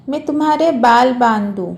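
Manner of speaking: ordinary delivery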